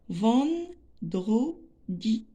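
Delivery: vendredi very slowly, ven-dre-di; teacher sounding out vendredi; split into syllables. Shown as a demonstration. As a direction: break vendredi into three pieces with gaps. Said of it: "on va découper les mots en syllabes".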